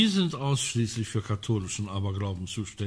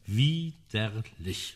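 disgusted drawl; 3 dB quieter, much slower than usual. Acted. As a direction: drag Widerlich out very slowly with distaste. Recalling the awful tea.

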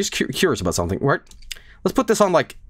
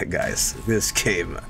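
mocking himself; 5 semitones lower, much slower than usual. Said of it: "I'm going".

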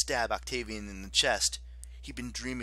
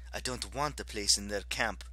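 plain reading voice

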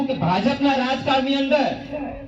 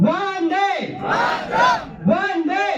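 high, ringing rally shout; urging slogan call; 6 semitones higher, much slower than usual. Real, not acted. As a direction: lift the cry to draw a stronger response. Repeats slogan, urging louder response.

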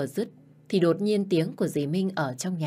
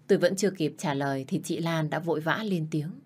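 ordinary delivery, nothing marked

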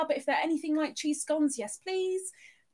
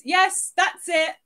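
usual speaking voice

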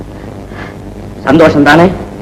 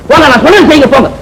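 commanding shout; 7 semitones higher, faster than usual. Acted. Father orders secretary.

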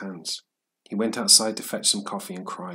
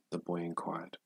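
plain reading voice

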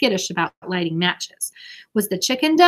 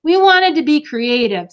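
slow, raised, sheepish defense; defensive; 7 semitones higher, 6 dB louder than usual. A narrator's reading. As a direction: explain it away. Beezus covering up.

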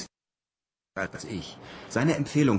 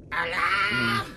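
parrot call; 10 semitones higher than usual. Acted.

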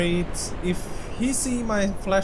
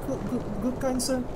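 scared pleading; 6 semitones higher, 4 dB quieter than usual. Real, not acted. Pleading politely with the ghost.

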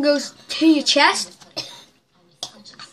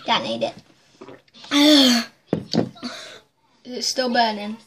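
usual speaking voice

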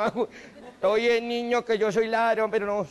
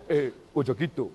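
half-hearted president impression; 8 semitones lower than usual. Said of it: "yo pa' qué imito si ni sé".

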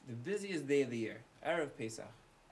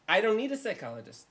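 higher, louder, fed-up outburst; exasperated shout; 7 semitones higher, 7 dB louder than usual. Acted. Homeowner to the card-pulling repairman, exasperated shout. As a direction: snap it out in disbelief.

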